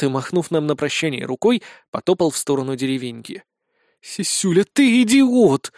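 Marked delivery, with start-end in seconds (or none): 4.0-5.7 high, drawn-out angry rebuke; growled insult. Acted. Blonde rounds on the sergeant, angrily growling. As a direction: lash out, stretching the insult. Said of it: "прорычала Безос".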